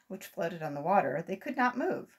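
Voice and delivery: ordinary delivery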